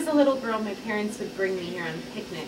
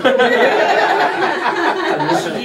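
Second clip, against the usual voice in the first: loud laughing; 3 semitones higher, 12 dB louder than usual. Real, not acted. Laughing at Doc's joke.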